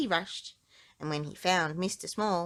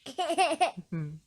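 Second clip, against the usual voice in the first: giggle; 8 semitones higher than usual. A narrator's reading. Narrator giggles.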